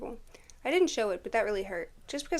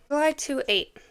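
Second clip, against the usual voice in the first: date said higher and louder; louder retake; 5 semitones higher, 7 dB louder than usual. Shown as a demonstration. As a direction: read the date out boldly.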